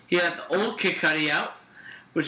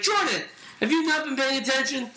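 raised-pitch mock teacher reprimand; imitating scolding teacher; 6 semitones higher than usual. Friend retelling a teacher catching Jordan, mock scolding. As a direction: lightly act a teacher calling out a pupil. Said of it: "she went through his notebook. She's like".

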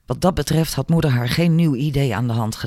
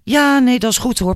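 much higher and louder, mock agreeing; pointed sarcasm; 9 semitones higher, 3 dB louder than usual. A narrator's reading. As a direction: overdo the agreement to threaten him. Maar haar ogen stonden fel.

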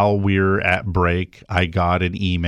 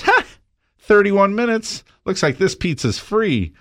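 mock-triumphant customer, pitched up high; gloating customer; 12 semitones higher than usual. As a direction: act like you just caught him out on the clock. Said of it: "the guy's like, looks at his watch and goes".

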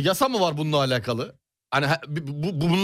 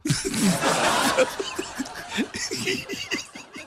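breathless high giggle; high airy laugh; 13 semitones higher, 3 dB quieter than usual. Real, not acted. Co-host laughing at his freedom joke, wheezing giggle.

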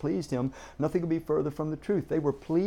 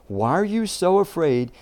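louder, pointed question; a little irritated; 5 semitones higher, 8 dB louder than usual. Retold or imitated. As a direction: press them on their fear. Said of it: "He really sounds a little bit irritated".

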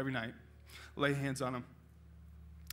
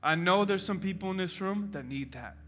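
closing prayer. Acted. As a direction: pray over them tenderly.

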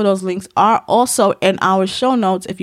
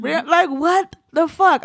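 exasperated; 9 semitones higher, slower than usual. Real, not acted. Exasperated over history overload.

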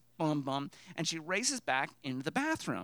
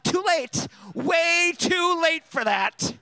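high, loud, stretched-out mock wail; hammed-up exasperated yell; frantic retort; 12 semitones higher, 11 dB louder, much slower than usual. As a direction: wail it back in comic panic. Frantic retort to nurse, retold.

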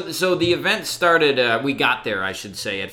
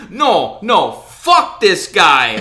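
loud, higher mock outrage, drawn out slowly; belligerent drunk; 5 semitones higher, 7 dB louder, much slower than usual. Drunk woman refusing to leave the store, mock belligerent. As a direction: imitate a drunk woman spoiling for a fight. Retelling woman putting up big fight.

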